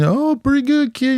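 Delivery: mock Southern drawl, cheerful old patient. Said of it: "You had that southern draw accent".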